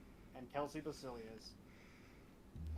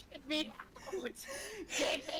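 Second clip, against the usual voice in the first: high-pitched mock cry for rescue; screamed plea. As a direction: cry to be let out. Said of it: "as she screams".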